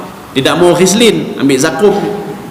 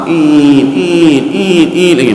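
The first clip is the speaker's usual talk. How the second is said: door creak imitation; much slower than usual. Shown as a demonstration. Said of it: "seperti suara pintu".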